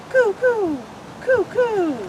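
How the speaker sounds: high cooing like a mourning dove; playful imitation of a dove's call. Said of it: "Morning doves take their morning stroll".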